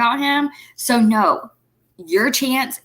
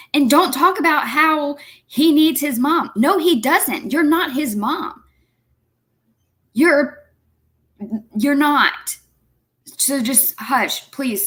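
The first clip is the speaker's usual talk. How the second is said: higher pitched, heated scolding; exasperated; 6 semitones higher than usual. Real, not acted. Scolding the mother on screen, higher and heated. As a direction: tell her off, voice climbing high.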